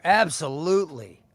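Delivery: ordinary talk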